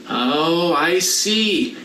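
ordinary delivery

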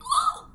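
sharp high startled gasp; scared gasp. Acted. Villagers jolted awake by the sneeze, scared gasp. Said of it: "they were all scared".

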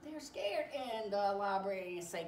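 plain reading voice

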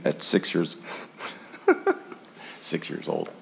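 real emotion breaking into the reading; choked up. Real, not acted. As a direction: overcome mid-sentence, finishing softly. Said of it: "Sorry, I get emotional".

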